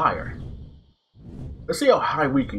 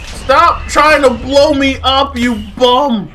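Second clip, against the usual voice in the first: loud, raised, drawn-out complaint; indignant shouting; 7 semitones higher, 6 dB louder, slower than usual. Real, not acted. Player to exploding enemy, indignant shout. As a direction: yell it at a pest. Scolding enemy that blows them up.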